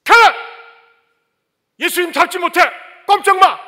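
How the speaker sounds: full-force bellowing defiance; a disciple yelling to stop the arrest; furious shout. Retold or imitated. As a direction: roar it at the arresting soldiers. Peter shouts, defending Jesus.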